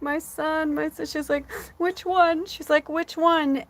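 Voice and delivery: high, tearful mother's voice, imitating her; pleading through tears, high pitched; crying mother. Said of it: "she starts crying".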